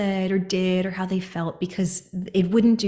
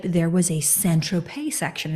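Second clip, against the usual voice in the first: ordinary talk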